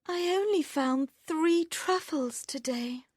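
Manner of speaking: put-on sad girl. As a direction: sigh over the few truffles. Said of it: "she thought sadly".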